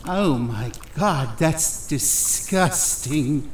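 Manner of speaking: slow, drawn-out disgust; grossed-out groan of disgust. Acted. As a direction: recoil from a vile smell. Smells behind his ear.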